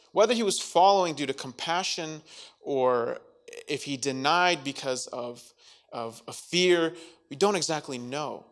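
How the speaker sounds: normal talking voice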